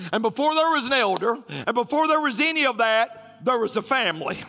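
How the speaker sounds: rising preacher cadence, each "before" pushed; preaching build. Acted. Preacher building to a climax before the congregation.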